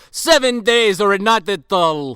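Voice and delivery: slow, loud Russian-villain declaration; Russian accent. Retold or imitated. Alexander setting fight terms, slow loud Russian accent. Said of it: "'Cause he's Russian".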